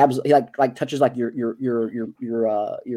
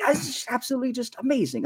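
high-pitched giddy marvelling; 10 semitones higher than usual. Acted. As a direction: play a tinkerer giddy with wonder. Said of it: "He's, like, almost giddy".

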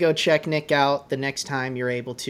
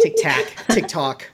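TikTok with a Boston accent, slow and louder; 2 semitones higher, 6 dB louder, slower than usual. Real, not acted. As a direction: say TikTok like Ben Affleck from Boston. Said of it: "sounded like Ben Affleck trying to say TikTok".

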